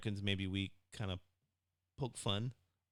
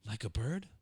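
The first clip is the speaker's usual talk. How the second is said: surprised. Acted. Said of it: "mock surprised question".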